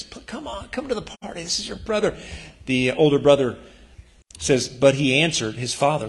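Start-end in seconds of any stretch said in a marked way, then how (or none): 0.0-2.2 soft, higher coaxing plea, much quieter; gentle pleading. Acted. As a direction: coax him gently to come inside. Father pleading softly with son.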